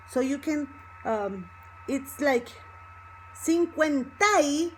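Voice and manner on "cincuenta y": "cincuenta y" higher, ending in a held "tai"; connected words. Shown as a demonstration. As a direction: link "y" onto "cincuenta" with stress on "ta-y". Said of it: "it's already connecting".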